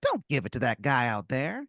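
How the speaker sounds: squeaky tooth making an offer; tempting tooth creature. Acted. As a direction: tempt him like a tiny mob boss. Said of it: "And he goes".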